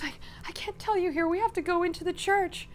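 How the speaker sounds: high, hurried, rattled plea; distraught urgency. Acted. Odette to Toulouse, unable to tell it here, distraught. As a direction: stammer it out high and upset.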